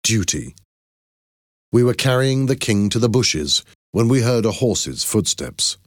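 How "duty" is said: plain reading voice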